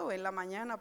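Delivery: ordinary talk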